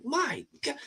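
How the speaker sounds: replaying his own wonder at the doll; amazed retelling. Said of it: "I'm like".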